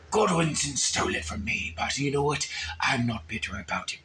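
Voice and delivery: low, soft grumbling aside; quiet. Acted. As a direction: mutter it under your breath. Trailing into mock bitterness.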